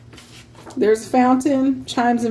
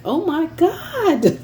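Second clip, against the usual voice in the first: mock shock; 8 semitones higher, slower than usual. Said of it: "And I'm like".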